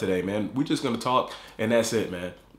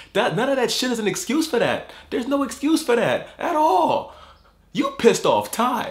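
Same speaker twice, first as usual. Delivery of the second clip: outraged; 9 semitones higher than usual. Real, not acted. Ranting at Jeff.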